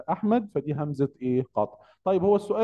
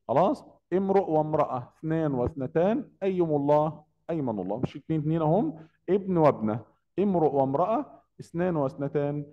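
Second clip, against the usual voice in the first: ordinary talk, nothing marked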